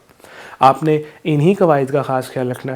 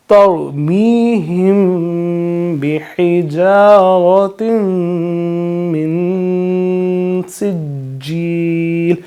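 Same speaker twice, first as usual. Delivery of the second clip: Quranic recitation; 3 semitones higher, much slower than usual. Shown as a demonstration. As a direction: recite it slowly with nasal ghunna. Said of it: "پچھلی آیت میں میں نے آپ کو بتایا".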